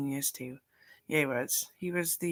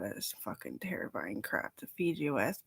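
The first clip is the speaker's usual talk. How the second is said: whisper; 6 semitones lower, 6 dB quieter than usual. Real, not acted. Whispered aside.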